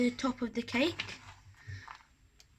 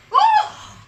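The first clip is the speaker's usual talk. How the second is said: sudden high startled cry; startled shout; 12 dB louder than usual. Real, not acted. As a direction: jump with a loud gasp of surprise. Startled by the oven.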